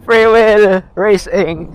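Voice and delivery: loud, drawn-out announcement; excited call. Real, not acted. Vlogger announcing a free-wheel race downhill, excited call. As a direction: stretch it out loudly, thrilled by the ride. Calling out during downhill ride.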